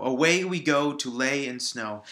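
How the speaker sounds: loud beats on "way", "go", "lay", "snow"; iambic rhythm. Shown as a demonstration. Teacher hoping students hear that rhythm, stressing every second syllable. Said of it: "I hope you can actually hear that rhythm".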